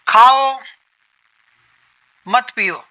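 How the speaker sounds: کھاؤ then a clear pause, then مت پیو; pause after khao. Shown as a demonstration. Teacher demonstrates a pause after کھاؤ. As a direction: stop after کھاؤ before saying مت پیو. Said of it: "کھاؤ پہ ہم کیا کیے؟ رک گئے".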